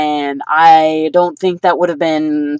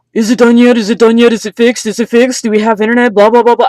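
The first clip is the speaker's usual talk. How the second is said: higher, louder nagging repetition; 5 semitones higher, 6 dB louder, faster than usual. Retold or imitated. Quoting how she kept asking about the internet, mock nagging. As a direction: pester over and over, louder each time. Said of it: "I was constantly asking him".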